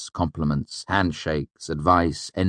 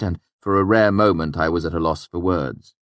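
usual speaking voice

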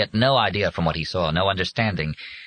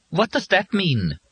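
demanding; 12 semitones higher, slower than usual. A narrator's reading. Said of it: "Molly demanded".

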